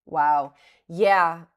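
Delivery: loud, delighted burst; a sudden loud whoop of delight; excited. Real, not acted. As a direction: cheer at the price jump. Big jump on the stock.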